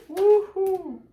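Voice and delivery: loud, high "woohoo"; excited whoop. Real, not acted. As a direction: let out a high, ringing whoop. Exclaims while dealing the cards. (cri d'exclamation enjoué)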